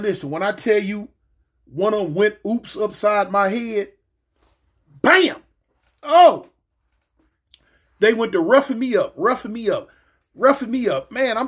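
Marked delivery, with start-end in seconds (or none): 5.0-5.4 loud, high bam; sudden vocal impact; imitated blow. 6.0-6.5 loud, high short oh; acted pain cry. Reacts to the blow, retold.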